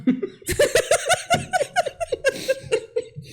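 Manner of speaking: real giggling fit; high laugh. Real, not acted. Laughing at her own joke.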